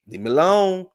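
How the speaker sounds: hammed-up high plea; mock whine. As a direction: whine it high like a wounded diva. Mock plea, playacting Kim.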